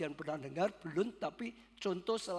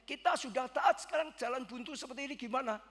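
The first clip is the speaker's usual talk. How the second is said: raised, rushed complaint to God; pleading up at heaven, hurt and fast; indignant plea; 6 semitones higher than usual. Retold or imitated. Pastor protesting to God that they obeyed, indignant and fast.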